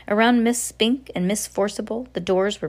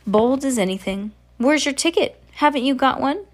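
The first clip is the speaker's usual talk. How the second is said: demanding voice; 7 semitones higher, 4 dB louder than usual. Acted. Character demands ticket.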